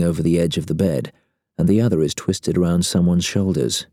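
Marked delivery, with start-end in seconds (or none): none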